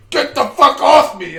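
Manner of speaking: loud high desperate shout; furious yell. Retold or imitated. Teacher on the ground fighting off the squirrel, desperate shout. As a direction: yell it while flailing on the ground.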